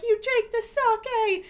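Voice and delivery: high, loud slow announcement; friends' reply. Retold or imitated. Friends telling the dazed streamer, high slow reply. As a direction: tell her what she did, slowly. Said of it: "And they're like".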